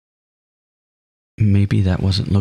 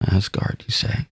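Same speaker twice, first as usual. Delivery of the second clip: breathy whispered musing; soft whispered echo; 5 dB quieter than usual. Acted. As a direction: whisper the name back to yourself. Character muses on the name.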